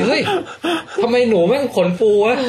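high, laughing mock astonishment; acted bewilderment. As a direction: play the baffled researcher, cracking up. Voices the puzzled researchers, laughing.

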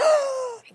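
sharp, high mock gasp; horrified gasp. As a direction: suck in air, imitating shocked children. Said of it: "gasped in horror".

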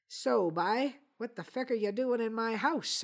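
put-on rough Newfoundland accent, moderately done. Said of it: "using as good a Newfoundland accent as I could manage".

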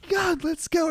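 high-pitched mock panic; retold scared urging. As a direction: act out urging a friend to flee. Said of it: "one of the most scared I've ever been".

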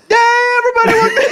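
high, loud yelled welcome; shouted intro greeting. Retold or imitated. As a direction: scream the greeting like a host. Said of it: "you go".

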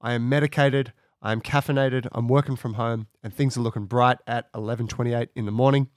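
normal talking voice

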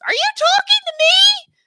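very high, airy mock voice; high whispery impression of a surprise caller; imitated startling voice. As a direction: squeak it out like a tiny voice from nowhere. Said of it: "I hear this voice".

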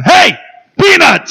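high, loud yelled Peanuts; stadium vendor hawking. Retold or imitated. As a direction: shout it like you mean business. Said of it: "No, he's up in there going".

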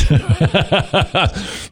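rapid run of chuckles; laughing. Real, not acted. Preacher amused at our big problem, laughing. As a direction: laugh at your own failing.

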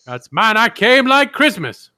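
high, loud gloating shout; loud boastful triumph. Acted. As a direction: crow about the win. Boasting as the horse-riding character.

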